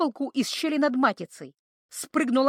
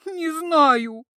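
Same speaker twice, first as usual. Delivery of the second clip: scared denial; 6 semitones higher, 5 dB louder than usual. A narrator's reading. Frightened creature.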